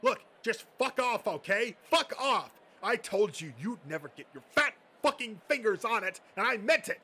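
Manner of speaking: loud, high screaming rage; high, loud tirade building to a scream; furious yelling. Acted. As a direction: unload years of spite on him. Bill finally explodes at Josh.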